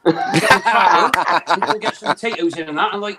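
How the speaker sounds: comic southern English farmer accent; hammed-up rustic farmer accent; West Country farmer accent. Shown as a demonstration. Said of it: "how do you do a farmer?".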